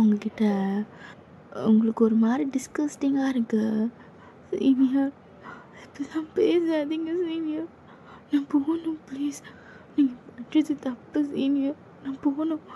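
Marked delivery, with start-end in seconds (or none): 4.6-12.7 put-on timid young woman begging him to stop; scared junior girl. Junior girl begging her senior to back off, high and timid.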